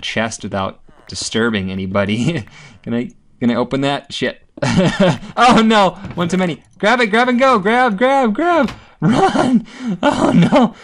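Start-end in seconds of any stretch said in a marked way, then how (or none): 4.6-5.3 high startled laugh as things go wrong; nervous high laugh; panicked burst of laughter. Real, not acted. Panicked laugh as mobs attack. 5.3-10.7 high, loud frantic yelling; real panic, much higher and louder; panicked shouting. Real, not acted. Chased by mobs, panicking.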